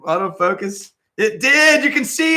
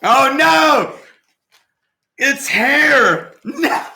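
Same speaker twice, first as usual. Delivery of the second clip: shocked; 7 semitones higher, 4 dB louder, much slower than usual. Real, not acted. Discovers hair in strainer.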